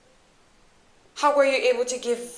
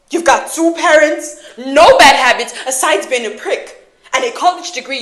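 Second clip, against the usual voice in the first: loud, heated listing of faults; raised, accusing voice; angry scorn; 6 dB louder than usual. Acted. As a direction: throw each point at them loudly. Acted quarrel, listing his privileges.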